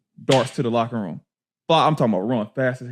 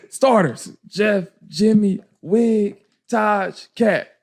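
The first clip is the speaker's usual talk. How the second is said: coach calling the lineup; 7 semitones higher, much slower than usual. Retold or imitated. Coach naming starters at scrimmage, higher roll-call voice. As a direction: imitate a coach calling names, higher pitch.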